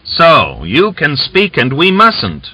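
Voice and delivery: defiant widow shouting up at the rider. A narrator's reading. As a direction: throw it back in his face. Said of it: "she shouted".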